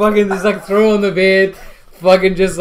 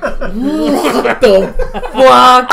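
louder, higher, hugely drawn-out reaction; appalled disbelief; 6 semitones higher, 6 dB louder, much slower than usual. Retold or imitated. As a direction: stretch each word in mock horror.

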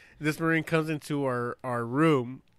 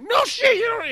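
angry; 7 dB louder than usual. Retold or imitated. Wife's snapping reply voiced.

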